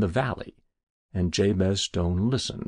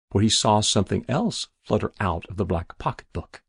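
ordinary delivery, nothing marked

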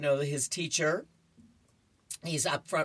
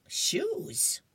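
whispering; 4 semitones higher than usual. Acted. Snoopy voiced in comic.

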